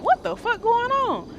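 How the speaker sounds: high, startled yelp of disbelief; retelling the moment gunfire broke out, in startled disbelief; shocked outburst. Retold or imitated. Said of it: "So I'm like".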